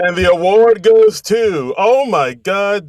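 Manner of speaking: loud, drawn-out awards reveal; mock awards announcer. Co-host pretends to win an award for his accent, mock awards announcer. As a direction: announce a winner with hammed-up slow suspense.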